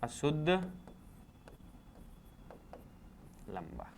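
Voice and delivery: each word set apart and said softly; hushed, spaced-out dictation for the class; slow dictation. Shown as a demonstration. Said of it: "कैसा?".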